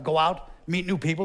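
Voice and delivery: usual speaking voice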